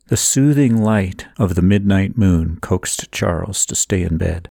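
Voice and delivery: ordinary delivery, nothing marked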